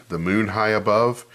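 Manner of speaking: plain reading voice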